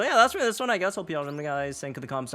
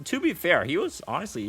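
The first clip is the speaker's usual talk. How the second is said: ordinary talk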